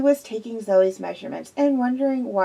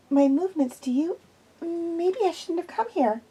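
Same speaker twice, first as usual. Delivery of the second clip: lightly raised, anxious woman's voice; nervous young woman; 5 semitones higher, 3 dB quieter than usual. A narrator's reading. Zoe tells Quillian she is nervous, lightly voiced anxious woman. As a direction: play Zoe uneasy and jumpy.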